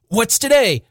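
high-pitched call, no real shout; eager call from above. A narrator's reading. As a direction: call brightly to someone below. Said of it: "calling down to a boy".

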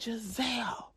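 urgent unvoiced whisper. Mother whispering her daughter's name in the car. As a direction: hush it to your daughter. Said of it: "I said".

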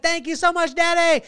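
put-on high, loud kid's thank-you; happy small son thanking his father; grateful little boy. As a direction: thank him like a delighted child.

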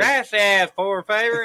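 put-on slow, loud Spanish in a hillbilly accent. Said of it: "did Hillbilly Joe just say".

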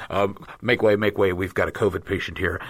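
low voice, lightly playing a medic; a hint of hospital urgency, pitched low; lightly voiced ambulance worker. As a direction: imitate a medic clearing the way. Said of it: "you hear the guy say".

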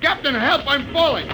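high, loud, terrified yelling; panicked cry for help. Acted. Briggs slipping into a shaft, calling the captain in panic. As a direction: scream for help as the ground gives way.

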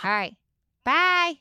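high, loud housewife sign-off; hammed-up reality-star farewell; Teresa. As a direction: sign off in character with a punched-up goodbye. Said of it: "Thanks, Teresa".

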